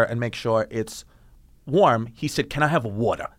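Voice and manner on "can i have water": put-on lower-pitched Staten Island accent on "water". Quoting a Staten Island comedian asking for a drink, Staten Island accent. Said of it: "how a kid from Staten Island".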